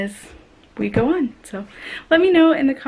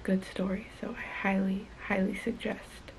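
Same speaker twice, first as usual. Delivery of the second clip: hushed voice; 3 semitones lower, 11 dB quieter than usual. Real, not acted. Late-night hushed talk.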